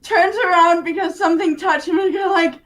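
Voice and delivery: ordinary talk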